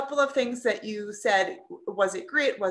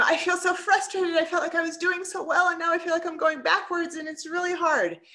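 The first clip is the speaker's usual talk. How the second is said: gushing frustration; 8 semitones higher than usual. Retold or imitated. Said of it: "So I gushed".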